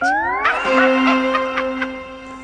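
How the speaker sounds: very high shrieking cackle; triumphant witch cackle. Acted.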